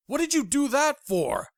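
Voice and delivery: high, loud shocked shriek. Acted. Paxton shocked at the stabbing, shriek. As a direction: shriek it in alarm. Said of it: "I shrieked".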